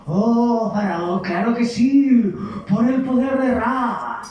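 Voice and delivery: high, slow and grand; boastful sorcerers. Acted. Sorcerers assuring pharaoh, high, slow and grandiose.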